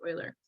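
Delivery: Euler as OY-ler, said softly; correct pronunciation. Shown as a demonstration. Teacher repeating the right name to students, Euler as OY-ler. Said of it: "but it's pronounced Euler".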